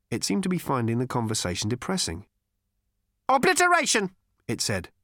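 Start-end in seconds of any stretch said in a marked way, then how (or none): 3.3-4.1 high, loud, mechanical robot voice; cold, lifeless robot. A narrator's reading. Robot confronts Zaphod about his gun, cold and lifeless. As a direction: ancient robot with a cultured rasp, pitched high. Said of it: "cold, sleek, and lifeless".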